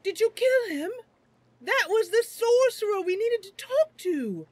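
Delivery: loud, high voice of alarm; Shelbin. Acted. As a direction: scold a companion in shocked disbelief. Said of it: "Shelbin comes running over and goes".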